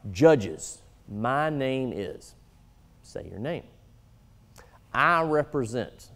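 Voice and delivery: slow, even, spelled-out introduction; walk them through it slowly; slow demonstration; slow repeat. Shown as a demonstration. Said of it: "then I'll repeat it slowly for you".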